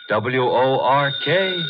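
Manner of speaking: each letter spaced out slowly; spelled out slowly. Acted. [name] spells the word out.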